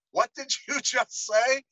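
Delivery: high, slow, laughing disbelief; shocked receptionist. Retold or imitated. Receptionist reacting to the slur, stunned and laughing. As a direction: ask it aghast while cracking up. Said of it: "looks up at him and goes".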